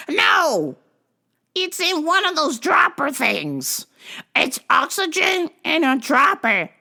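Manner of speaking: high, slow insistence; lightly hammed-up caller voice; mock insistent lady. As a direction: play the stubborn old caller.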